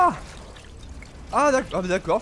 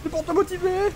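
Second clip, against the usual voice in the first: mock taunt. Taunting the boss.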